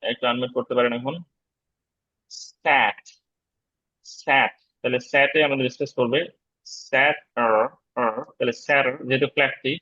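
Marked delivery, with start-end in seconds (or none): 2.3-4.5 স্যাট said with a short flat vowel, falling; teacher modelling a word. Shown as a demonstration. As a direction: clearly model স্যাট for students to repeat. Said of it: "দেখেন তো এটা কি উচ্চারণ হতে পারে".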